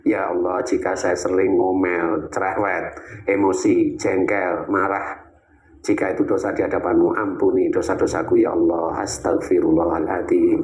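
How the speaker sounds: low, slow, calm supplication; calm prayer. Shown as a demonstration. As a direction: pray it calmly, let it sink in. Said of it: "Lantunkan kalimat itu dengan tenang".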